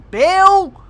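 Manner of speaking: calling out. Real, not acted. Calling out to the ship's AI.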